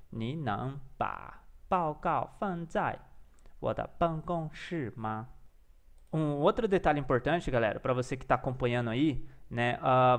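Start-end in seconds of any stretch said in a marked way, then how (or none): 0.1-5.3 slow, word-by-word Mandarin; careful drilling. Shown as a demonstration. Learner practising an office question, slow and careful. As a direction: repeat it unhurried, in short chunks.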